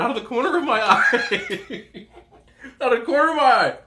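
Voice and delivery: laughing through the words, voice climbing; giggling as he tries to get it out; breaking into laughter mid-sentence. Real, not acted. Laughing while telling story.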